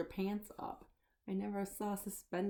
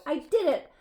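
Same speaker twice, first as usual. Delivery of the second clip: high, loud victory shout; triumphant monster shout; triumphant cheer; 8 semitones higher, 8 dB louder than usual. Acted. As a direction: crow over your win. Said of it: "cheered Leonardo".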